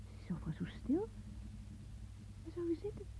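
hushed anxious wondering; uneasy whisper. Acted. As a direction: whisper it into the silent house. Uneasy in the silent house.

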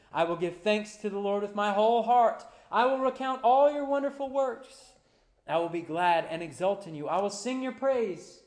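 rising, loud preacher's cry; full-force preaching. Acted. Pastor to congregation, preaching at full force. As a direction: lift the room toward the table.

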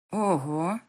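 loud, stunned wow. A narrator's reading. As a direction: react as if floored. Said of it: "ошарашенно произносит Настя".

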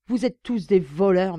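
loud accusing cry. A narrator's reading. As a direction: hurl the accusation. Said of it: "m'écriai-je".

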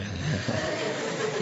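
quiet chuckle after a joke; soft laugh. Real, not acted. Teacher chuckles at his own joke to the pupils. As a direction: laugh it off gently. Joke about feeding a child.

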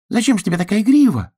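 high, quick surprised duck voice; a squeaky little duck, amazed. Acted. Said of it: "удивился Чирок".

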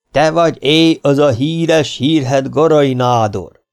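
loud, high, slow bellow at the mayor; booming challenge. A narrator's reading. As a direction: roar it out like a warlord. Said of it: "harsogott a polgármester felé".

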